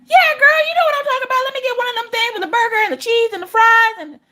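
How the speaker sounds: very high, excited squeal of an order; over-the-top excited ordering; hyped-up drive-thru customer. Acted. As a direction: gush it high and animated, piling items on. Excited customer ordering from country Alexa.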